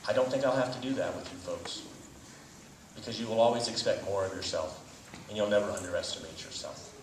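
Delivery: ordinary delivery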